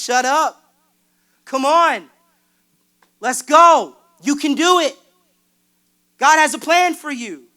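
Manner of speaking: loud, high, pumped-up encouragement; rallying pep talk. Pretend. Friend on the phone cheering him on, loud and pumped. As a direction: fire them up like a coach.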